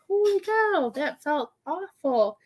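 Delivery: high-pitched, drawn-out holy cow; retold stunned reaction. Medium reacting to a painful sensation, stunned. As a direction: stretch it out, reeling from the feeling. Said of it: "I'm just like".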